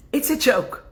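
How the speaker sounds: ordinary delivery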